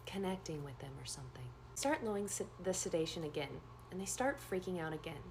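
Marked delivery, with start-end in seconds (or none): 0.1-1.5 whispered confession, voiceless. Real, not acted. Nurse confessing she looked up a patient, whispered. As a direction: breathe it out like a guilty secret.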